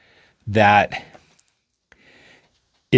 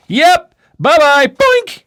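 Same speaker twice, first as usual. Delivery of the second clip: teasing goodbye; 5 dB louder, slower than usual. Acted. Figure teases then vanishes.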